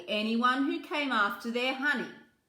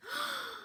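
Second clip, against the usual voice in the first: soft gasp; 7 dB quieter than usual. Acted. Reader gasps.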